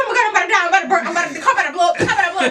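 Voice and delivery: half-laughing fear of being trapped; retold panic.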